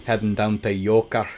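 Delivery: low mock Scottish accent; bad Scottish accent. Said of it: "bad Scottish accent".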